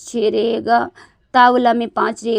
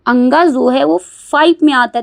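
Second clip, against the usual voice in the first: louder emphasis; 2 semitones higher, 8 dB louder than usual. Shown as a demonstration. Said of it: "आपका".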